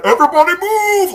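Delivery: high, booming mock command; Princess Bride shout. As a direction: holler it to part a crowd. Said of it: "you know, Princess Bride".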